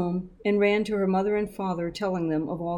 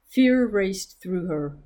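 ordinary delivery, nothing marked

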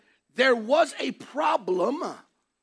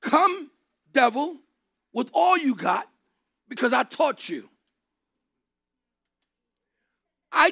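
God calling out the devil; 2 semitones higher, 4 dB louder than usual. Acted. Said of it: "It is".

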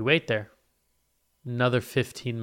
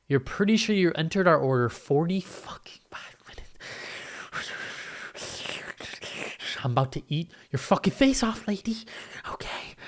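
furious whispered rant; seething whisper; 6 semitones higher than usual. Retold or imitated. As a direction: hiss it out under your breath, hungry and furious. Hangry muttering at waitress.

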